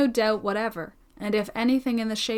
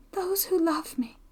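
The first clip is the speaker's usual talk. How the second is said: put-on higher, softer, half-whispered girl's voice; wounded echo; 7 semitones higher, 4 dB quieter than usual. Young woman questions her friend's mention of loved ones, softly wounded. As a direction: echo the words wistfully, high and hushed. Girl echoes his words, hurt.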